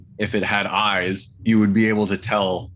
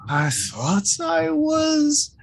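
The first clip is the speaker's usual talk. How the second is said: high, slow, disappointed creature voice; 12 semitones higher, much slower than usual. Acted. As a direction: speak as a let-down otherworldly servant. GM holds creature voice.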